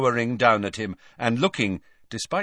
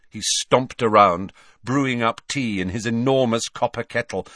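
plain reading voice

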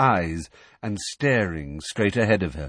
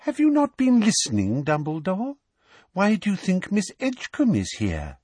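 high sugary sweet voice; falsely sweet, talking down; Umbridge voice; 9 semitones higher than usual. A narrator's reading.

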